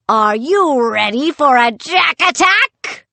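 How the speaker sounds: loud, high pirate challenge; rousing put-on pirate battle cry; taunting pirate puppet. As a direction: holler it like a menacing toy pirate. Pirate puppet threatens the children.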